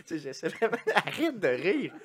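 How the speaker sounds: laughing to the point of crying. Real, not acted. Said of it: "je pleure".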